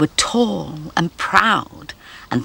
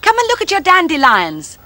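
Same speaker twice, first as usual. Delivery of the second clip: calling out; 13 semitones higher, 6 dB louder than usual. Real, not acted. Calling the dog over.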